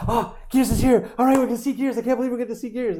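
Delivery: high-pitched, giddy fan squeal, quickening; excited fans. Retold or imitated. Fans reacting to the Gears reveal, excited and high. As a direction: gush like a fan who can't believe it. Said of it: "reaction videos are all like".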